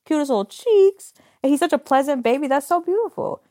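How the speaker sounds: high-pitched cooing adoration; sweet, high gush of delight. Real, not acted. Gushing over coworker's baby.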